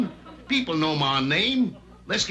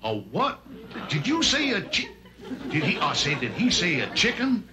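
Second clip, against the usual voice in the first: indignant high cartoon rooster, sputtering I say; Foghorn Leghorn; 6 semitones higher than usual. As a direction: huff and stammer like an offended Southern rooster. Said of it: "Mister Foghorn Leghorn".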